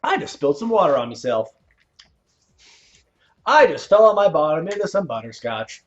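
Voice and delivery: lightly hammed-up comedian's bit; comedian quote. Streamer quoting a favorite comedian, lightly hammed up. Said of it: "so I quote him often".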